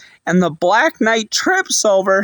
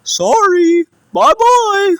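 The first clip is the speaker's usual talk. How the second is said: much higher and louder cartoon dog voice; Scooby voice; 12 semitones higher, 5 dB louder, slower than usual. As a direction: squeak it out like a fleeing cartoon dog. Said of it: "and he went".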